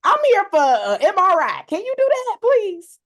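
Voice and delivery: high, loud pleading voice; exaggerated high pleading request; high-pitched plea. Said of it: "I'm like".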